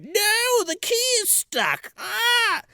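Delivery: high mock-panicked wail ending in a cry; panicked queen. Queen finds her key stuck, high hammed-up panic. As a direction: melodramatic villain losing it, high-pitched.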